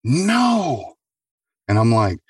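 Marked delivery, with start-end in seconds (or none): 0.0-0.9 much higher, pleading no; frightened refusal. Retold or imitated. Dying patient to orderly, high frightened no. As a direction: beg not to go, voice up high. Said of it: "And she's like".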